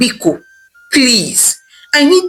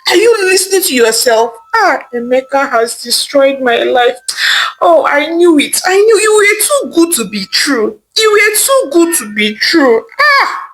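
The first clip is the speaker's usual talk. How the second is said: crying, shouting, much higher outburst; betrayed wife crying out at her husband; distraught; 5 semitones higher than usual. Acted. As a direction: break down in anguished cries. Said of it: "Ijeoma said very sadly".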